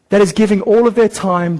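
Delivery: ordinary delivery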